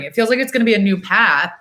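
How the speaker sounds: ordinary delivery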